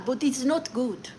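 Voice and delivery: put-on Russian accent, admittedly not good. Streamer warning a viewer about her attempt, Russian accent. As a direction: try a rough mock Russian accent. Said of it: "Can you do a Russian accent?".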